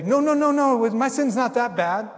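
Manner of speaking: high, loud no-no-no then slow excuse; denial. Retold or imitated. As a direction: brush off the guilt in protest. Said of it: "we want to say".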